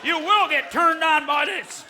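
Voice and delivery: high, drawn-out mock order; mock command. Performer orders the crowd to get turned on, mock command. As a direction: belt it out slowly as a high-pitched command.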